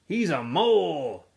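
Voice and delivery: slow, odd put-on kid voice; drawn out like a weird joke; Zigzag's voice. A narrator's reading. Zigzag mocking Zero to the boys, slow and odd.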